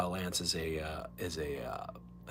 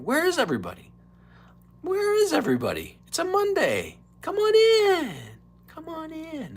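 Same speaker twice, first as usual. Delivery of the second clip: high, loud, stretched-out Biden calls; Dana Carvey's Joe Biden; 7 dB louder than usual. Retold or imitated. Host imitates Biden calling his chat in, high and loud. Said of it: "my impression of Dana Carvey doing a Joe Biden".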